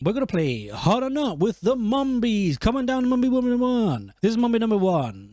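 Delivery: mock game show announcer; game show host.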